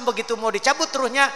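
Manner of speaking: ordinary talk, nothing marked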